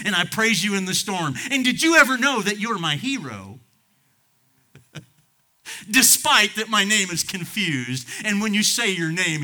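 loud, rising praise; preaching swell. Acted. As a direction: lift it up.

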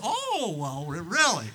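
high startled oh; surprised. Retold or imitated. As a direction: pastor reacting to the forty thousand price, mock surprise. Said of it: "I said".

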